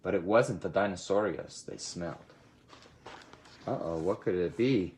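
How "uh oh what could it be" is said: ordinary delivery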